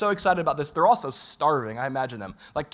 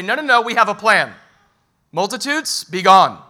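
loud, higher, shooing the crowd off; mock dismissal; 4 semitones higher, 8 dB louder than usual. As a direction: play the disciples' wish: banish the crowd with a half-comic shout. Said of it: "disciples are expecting Jesus to say".

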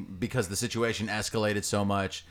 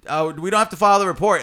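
higher, louder and fast; mock cop voice; 7 semitones higher, 7 dB louder, faster than usual. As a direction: toss off a dismissive cop line. Retold dismissive quote, loud fast.

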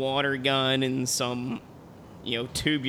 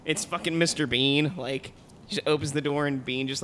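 ordinary delivery

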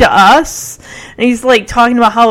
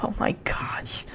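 whisper; 16 dB quieter than usual. Retold or imitated. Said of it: "It's like".

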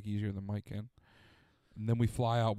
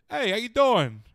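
loud, high New York greeting; hammed-up New York guy hailing a stranger; New York accent; 11 semitones higher, 8 dB louder, slower than usual. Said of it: "His accent comes out sometimes".

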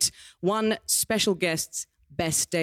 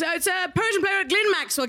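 announcer shout; 8 semitones higher than usual. Real, not acted. Host introducing guest to audience.

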